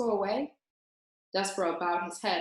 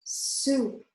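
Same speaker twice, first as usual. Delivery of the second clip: moaning; 4 semitones higher than usual. A narrator's reading. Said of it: "moaned Roscuro".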